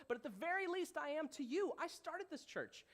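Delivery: high-pitched, insistent plea; emphatic retort. Acted. Paul reminding his own church who started it, insistent. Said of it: "Paul is saying to them".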